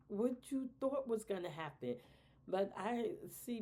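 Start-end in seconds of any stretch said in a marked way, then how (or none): 0.1-2.0 incredulous. Real, not acted. Incredulous rhetorical question.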